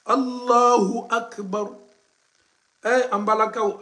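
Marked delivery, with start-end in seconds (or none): 0.1-1.7 "Allahu Akbar" slow with a punched "Hu"; slow model recitation. Shown as a demonstration. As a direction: repeat slowly, hitting "Hu". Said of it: "répétez ça pour bien prononcer".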